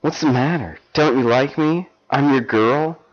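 high, wheedling seductress; coaxing lover. Acted.

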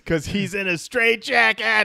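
high, loud comic babble building from the punchline; lightly acted straitjacketed singer. Podcaster mimicking Draymond wheeled out in a straitjacket, hammed-up babble. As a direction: raise the pitch into hammed-up nonsense syllables.

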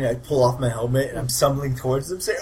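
ordinary talk

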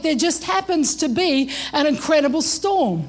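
usual speaking voice

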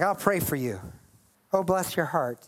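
low, lightly condescending blessing; spiritual arrogance. Acted. Believer pitying the opponent, mildly arrogant holy confidence. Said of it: "like, a spiritual arrogance".

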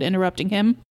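ordinary delivery

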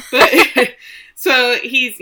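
slow words through a real laugh; giggling while trying to go on. Real, not acted. Laughing after bad news.

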